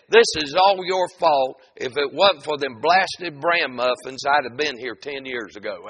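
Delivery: loud, high outburst easing toward the end; acted furious husband in a joke; angry old man. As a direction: blame her in a hammed-up fit of anger. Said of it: "went into a fit of anger".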